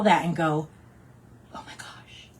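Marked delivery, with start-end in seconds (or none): 1.5-2.3 breath-only, hushed amazement; voiceless whisper of wonder; awed whisper. Acted. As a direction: whisper it as if struck by a memory. Imagined customer overcome by a memory.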